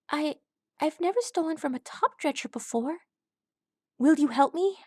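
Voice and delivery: high, faltering worried filly; hesitant filly. A narrator's reading. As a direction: stumble through it unsure. Crochet hesitant.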